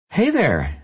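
a bright, smiling hello. Acted. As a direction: smile in her voice. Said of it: "she says with a smile in her voice".

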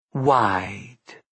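drowsy slurred murmur; talking in her sleep, slurred; slurred sleep-talk whisper. Acted. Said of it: "in the slurred whisper of one who talks and yet sleeps".

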